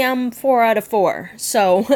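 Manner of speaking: ordinary delivery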